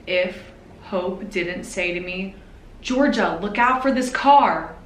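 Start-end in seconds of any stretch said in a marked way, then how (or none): 2.8-4.7 retold urgent shout, louder and higher; warning shout. Sister Hope warning Georgia about a car, shouting. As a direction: yell the warning at her sister. Sibling warns of speeding car.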